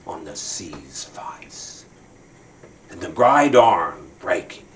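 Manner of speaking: slow, hushed whisper. Acted. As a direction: breathe the words out softly. Dramatic poem recital.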